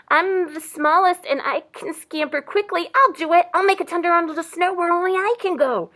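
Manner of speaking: high, eager little mouse. A narrator's reading. Tiny mouse offers to tunnel under snow, high-pitched. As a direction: pipe up as a tiny volunteer. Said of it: "Little itty bitty mouse".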